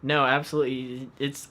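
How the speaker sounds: ordinary talk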